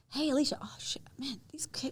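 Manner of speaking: hushed, whispered aside to a friend; airy whispered chatter, friend to friend; casual whisper. Retold or imitated. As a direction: whisper it like a relaxed buddy. Casual peer chat acted out.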